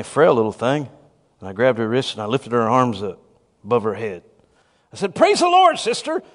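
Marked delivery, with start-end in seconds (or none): 5.1-6.2 high, loud command to praise; forceful preacher's holler; insistent shout. Retold or imitated. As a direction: badger her into praising. Preacher badgering frail woman to praise.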